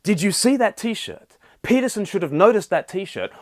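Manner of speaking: ape people demanding whether you saw it; mock outraged asking. Quoting people online, mock indignant. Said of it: "people have asked".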